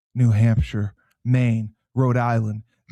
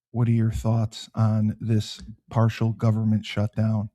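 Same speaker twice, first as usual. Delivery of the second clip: normal talking voice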